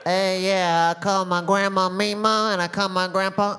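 put-on a light Mexican accent. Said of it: "Did you just put on a fake Mexican accent?".

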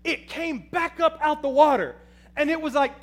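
normal talking voice

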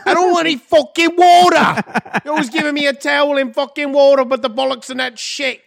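loud, high-pitched cartoon English accent; shrieky mock-English whine. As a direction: protest in a hammed-up English accent. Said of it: "I'm giving her an English accent".

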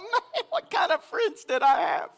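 high, loud teasing outrage; mock indignation. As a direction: rib the friends, pretend offended.

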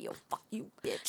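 low-volume, nearly voiceless curse; retold muttered insult. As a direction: toss the insult out under your breath. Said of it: "I would've been like".

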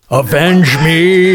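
slow, high, drawn-out dying cry; overacted death scene. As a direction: ham up a dying hero's last words. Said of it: "don't forget about Red Dawn".

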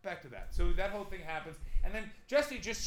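ordinary talk

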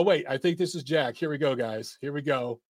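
usual speaking voice

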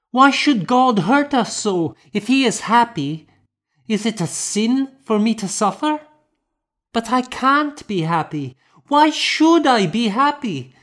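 put-on high, pleading little girl's voice; a hurt child arguing with grief; anguished child. A narrator's reading. Grieving child questions God.

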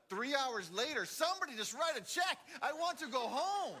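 loud, high pleading groan; a bored thirteen-year-old begging to leave; impatient teen whine. Retold or imitated. Teen stuck in a long service, loud and high complaint. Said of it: "And I was like".

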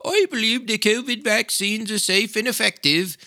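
high mocking impression of the prime minister's stock phrase. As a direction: ridicule the line as a pompous recital. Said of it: "he says".

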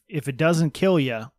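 ordinary delivery

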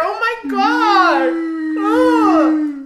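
slow, loud, laughing groan; exasperated laugh-cry; laughing disbelief. Real, not acted.

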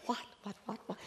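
hushed, stunned stammer; softly baffled, words repeated; taken aback. Retold or imitated. Taken aback by the women's demand.

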